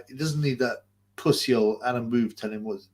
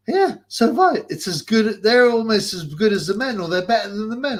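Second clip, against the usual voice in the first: higher, louder mock voice of a pub bloke; imitating a pub guy; 8 semitones higher, 6 dB louder than usual. Pub regular praising the Women's World Cup, mocked in a higher, louder voice. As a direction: lampoon the guy with a raised, silly voice. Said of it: "this guy turned around and goes".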